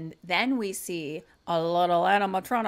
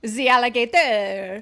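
slow, louder bad French accent; mock French accent; 2 semitones higher, 7 dB louder than usual. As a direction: stretch it out in a hammed-up French accent. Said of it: "Why can't I do a French accent?".

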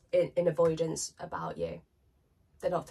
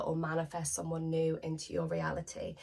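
ordinary talk

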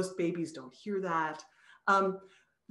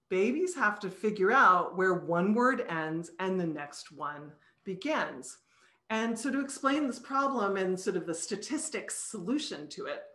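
ordinary delivery

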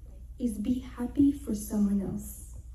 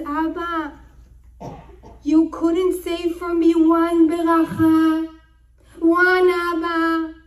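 loud, high drawn-out plea; anguished scream; 5 semitones higher, 9 dB louder, slower than usual. Retold or imitated. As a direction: cry out to a father, building to a yell. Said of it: "He screams from within, he yells".

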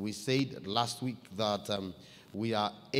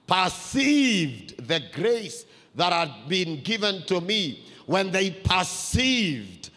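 full-force preaching; 6 semitones higher, 7 dB louder than usual. Acted. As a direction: preach it to the church with fire.

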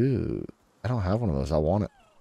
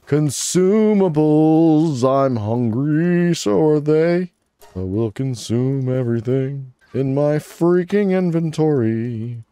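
mock monster voice; 5 semitones higher, slower than usual. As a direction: ham it up as a hungry monster. Hungry, eating inventory.

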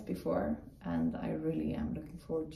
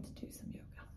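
soft unvoiced whisper; 17 dB quieter than usual. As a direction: whisper it gently. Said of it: "repeated as whisper".

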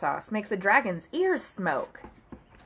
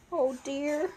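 usual speaking voice

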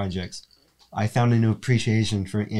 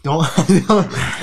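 high, loud laughing protest; 8 semitones higher, 8 dB louder than usual. Real, not acted. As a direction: laugh out a pleading don't. Pleading with cohost, mid-sneeze.

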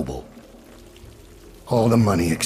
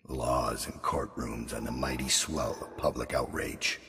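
hushed menace; 7 dB quieter than usual. Acted. Assassin gloating over the fallen Lord Regent, hushed and cold. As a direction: murmur it over the beaten man like a private verdict.

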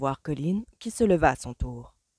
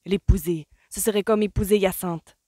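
exasperated outburst; 4 semitones higher, 6 dB louder than usual. A narrator's reading. Colin exasperated.

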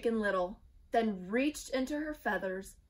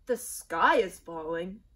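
doubtful king; 6 dB louder than usual. A narrator's reading. King challenges the birds, loud and incredulous. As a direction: question the bird in disbelief.

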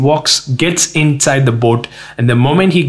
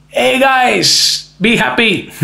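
high, drawn-out jolly hail; comically upbeat and high-pitched; mock-cheery greeting; 10 semitones higher, slower than usual. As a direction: greet them with absurd cheer. Comic paraphrase of Jesus' greeting.

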